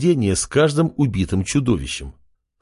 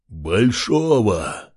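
slow, drawn-out monster voice; monstrous voice; much slower than usual. A narrator's reading. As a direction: stretch it out like a sea monster. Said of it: "весьма чудовищным голосом".

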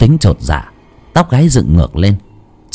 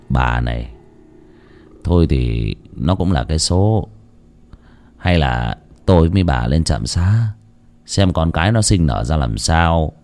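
put-on lower, softer old man resigned; old husband's voice; 5 semitones lower, 5 dB quieter than usual. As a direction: gently coax your wife after a loss. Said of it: "Ông mới nói".

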